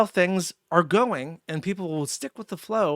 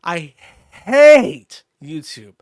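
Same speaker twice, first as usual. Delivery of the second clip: loud, slow, seething; real anger, every word hammered; angry outburst; 6 dB louder, slower than usual. Real, not acted. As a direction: spit it out, slow and furious. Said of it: "I actually get genuinely angry".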